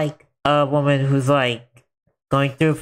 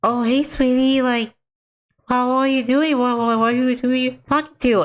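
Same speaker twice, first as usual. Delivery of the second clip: high, sugary mother asking gently; higher mom voice, asking her daughter; sweet mom voice; 9 semitones higher than usual. Retold or imitated. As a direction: play the sing-song concerned mom. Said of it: "the mom goes up to the little girl".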